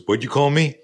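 high, loud retold challenge; confrontational challenge. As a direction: act out a bully squaring up. Bully confronts student nose to nose.

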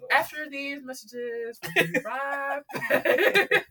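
slow, high announcer voice; mock break for commercials.

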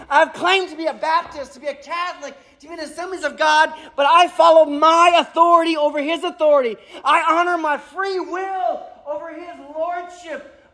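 high, desperate confession of guilt; anguished confession. Acted. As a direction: pour it out in fear and shame. Isaiah's confession voiced in dread.

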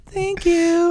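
put-on high, dainty child voice; sweet little girl. Dad imitating his daughter taking candy, all sweet. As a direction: pipe it up sweetly like a small daughter. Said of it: "Being all sweet".

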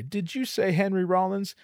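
high-pitched incredulous check; disbelieving question. Retold or imitated. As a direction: ask as if you misheard. Feigns disbelief at the name.

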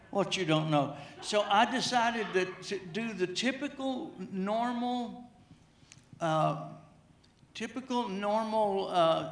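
ordinary delivery

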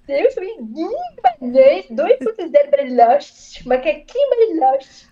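drawn-out, hammed-up comedian catchphrases; Igor Guimarães. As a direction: do a slow, exclaiming comic impression. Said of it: "Eu automaticamente imito o Igor Guimarães".

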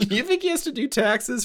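high, giggling question; laughing disbelief. Real, not acted. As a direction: ask through laughter.

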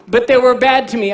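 a believer whining to Jesus about being wronged; whiny complainer. Acted. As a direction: complain like a wounded churchgoer.

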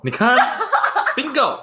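high teasing laugh. Real, not acted. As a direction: crow with laughter, caught you. Teasing his friend, caught out.

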